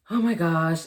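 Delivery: a loud burst of disbelief; loud exclamation. Real, not acted. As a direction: blurt it out loud, overwhelmed. Stunned memory of being pregnant.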